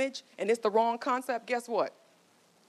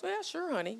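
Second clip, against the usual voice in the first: husband's voice; 10 semitones higher, 5 dB quieter than usual. Retold or imitated. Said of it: "And the husband goes".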